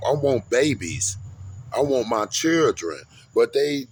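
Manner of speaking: usual speaking voice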